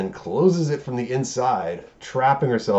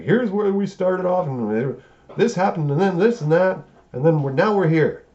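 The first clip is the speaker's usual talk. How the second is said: higher, louder sing-along recap voice; mock presenter; 10 semitones higher, 4 dB louder than usual. Studio briefing the audience before the movie, mock presenter. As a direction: pitch it up like an exasperated slideshow presenter.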